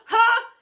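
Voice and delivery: sharp high "ha" of effort; loud grunt of a leap; effort cry. A narrator's reading. Teacher leaping to intercept a ball, effort cry.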